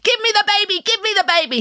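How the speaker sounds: high, insistent, excited demand. A narrator's reading. Little man snatching at the Queen's baby, demanding excitedly. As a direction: demand it greedily, pitch jumping right up. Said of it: "he demanded".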